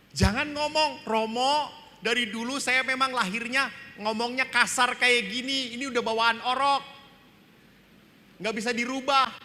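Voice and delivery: high, loud whiny excuse; mock stubborn excuse. Priest mimicking a stubborn churchgoer, high and loud. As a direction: mimic someone who refuses to change.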